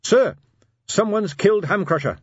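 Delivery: high, loud urgent announcement; urgent report. Acted. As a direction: break urgent news to your superior. Captain bursts in with urgent news.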